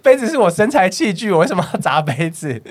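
high, loud, indignant protest; indignant denial. Retold or imitated. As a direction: insist hotly it wasn't you. Retelling denial to regular. (拔高声音激动地否认)

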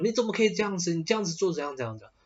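mock scolding. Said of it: "或者是直接大骂说".

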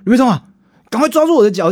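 high, loud panicked yelling; retold rescue, shouting to his friend to grab his feet; frantic shout. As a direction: yell for help as if dangling from a balcony.

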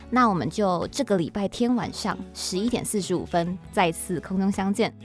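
normal talking voice

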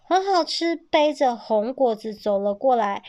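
ordinary delivery, nothing marked

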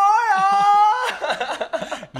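howling laugh, high pitch; loud laughing wail. Real, not acted. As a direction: laugh into a crying shout.

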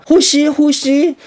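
high, slow coaxing; coaxing nurse. Retold or imitated. Nurse waking the patient, a high, slow coaxing voice. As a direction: draw it out to wake a patient. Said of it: "他就说".

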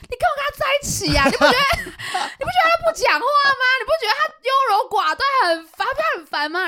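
loud teasing. Retold or imitated. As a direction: needle her loudly and laughingly. Teasing friend to his girlfriend.

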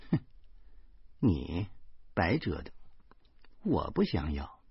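low, soft dismissiveness; scoff, then refuse coldly under your voice; cold sneer. A narrator's reading. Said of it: "严明冷笑着说". (冷笑着低声说)

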